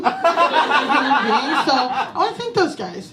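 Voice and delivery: ordinary delivery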